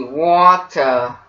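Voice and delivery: British-accent Water, T fully sounded; British hard T. Shown as a demonstration. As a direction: say Water British as "waw-tuh". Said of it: "say the T as a hard T".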